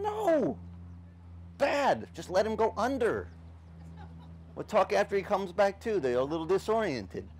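high, pleading argument; arguing with God. Retold or imitated. Young fighter arguing with the Spirit, high and pleading. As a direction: push back like a stubborn kid. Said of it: "I'm thinking".

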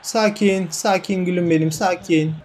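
soft self-soothing murmur; softly talking himself into calm. Real, not acted. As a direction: hush yourself down, gently. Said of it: "Pozitif düşün [name]".

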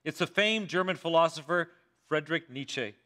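ordinary delivery

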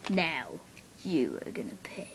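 low, creepy whispered threat. Acted. Threatening the horse, a low creepy whisper. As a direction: whisper a menacing promise. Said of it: "don't say it like that. It's creepy".

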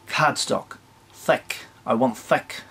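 cardstock and thick in a broad Kiwi accent; a playful New Zealand accent. Shown as a demonstration. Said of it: "Said that in a very New Zealand accent".

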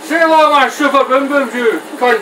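loud, high gloating war cry; gleeful taunt. Real, not acted. Gamer taunting enemies in the game as he fires, gleeful loud gloat. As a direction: crow it at the enemies like a movie villain.

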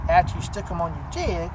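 ordinary talk

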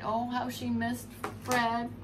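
ordinary delivery, nothing marked

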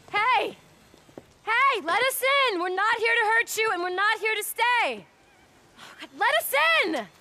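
high, loud pleading calls; shouting. Acted. Sarah shouting to the people inside to let them in. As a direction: yell through the door to be let in.

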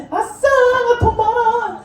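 high, drawn-out sing-song crowing; gleeful bragging. Acted. Younger son showing off his money, gleeful bragging. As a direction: crow with delight in a high voice.

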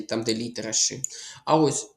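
ordinary talk

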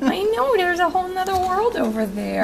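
sing-song reassurance, pitched right up; cooing pet talk. Real, not acted. Soothing the cat about the world over there, high cooing.

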